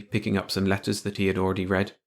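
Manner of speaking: plain reading voice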